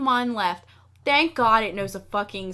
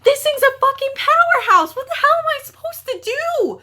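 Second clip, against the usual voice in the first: high, loud, laughing panic; shrieking at an overpowered boss; panicked yell; 11 dB louder than usual. Retold or imitated. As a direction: yell it in disbelief, half laughing. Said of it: "And I was like".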